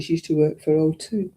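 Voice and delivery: usual speaking voice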